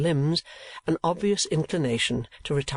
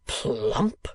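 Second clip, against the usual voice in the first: horrified exclamation; 3 semitones lower than usual. A narrator's reading. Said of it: "exclaimed the manager, quite horrified".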